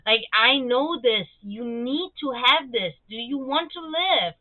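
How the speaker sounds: slow, emphatic retold plea; imitating doctors urging a reluctant patient; pleading doctors. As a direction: plead slowly, word by word. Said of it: "they're, like, pleading with them".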